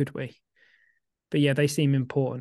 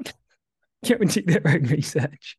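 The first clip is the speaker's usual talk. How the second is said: laughing through the words, stretched slowly; slower than usual. Real, not acted. Let a laugh break up the slow phrase. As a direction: podcaster cracking up while finishing advice to listeners, laughing. Cracks up completing a stock phrase.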